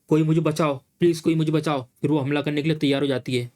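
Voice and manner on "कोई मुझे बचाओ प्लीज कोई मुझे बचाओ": ordinary delivery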